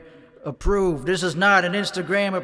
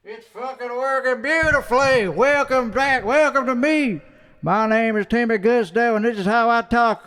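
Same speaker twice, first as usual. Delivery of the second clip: loud, high hype intro; excited; 6 semitones higher, 6 dB louder than usual. Real, not acted. Streamer greets viewers, loud and excited. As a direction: pump up the stream opening.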